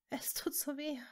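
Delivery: high, whispered gasp of pain. A narrator's reading. Wounded Hermine in Severus's arms, gasping faintly. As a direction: barely voice it, breathless with pain. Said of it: "keuchte sie".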